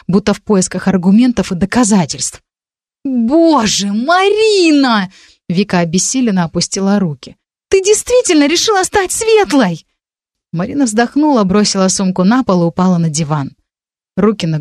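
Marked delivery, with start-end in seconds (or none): 3.0-5.1 high, drawn-out appalled cry. A narrator's reading. Friend horrified at the news. 7.7-9.9 very high-pitched incredulous question. A narrator's reading. Friend horrified at the news.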